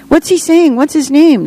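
put-on high-pitched, eager kids' voices; children's questions. A narrator's reading. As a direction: pipe up high like children pressing for answers. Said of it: "The questions from the children".

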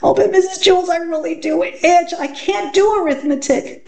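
put-on high, pleading whiny child; itchy schoolgirl begging off in a high voice; whining. A narrator's reading. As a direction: whine high at the teacher. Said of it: "Dana whined".

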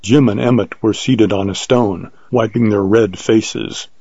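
usual speaking voice

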